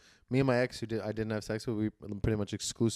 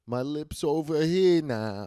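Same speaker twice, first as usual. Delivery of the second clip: slow, higher, slurred comic impression; 5 semitones higher, 4 dB louder, much slower than usual. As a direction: drag the words out like a brash comic.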